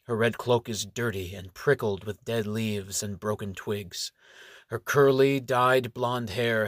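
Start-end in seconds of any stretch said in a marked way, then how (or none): none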